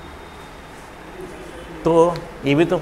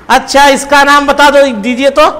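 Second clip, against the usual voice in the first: higher, louder dare to the class; challenging call; 6 semitones higher, 6 dB louder than usual. Acted. Teacher daring the students to name it, loud. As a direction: throw down the challenge.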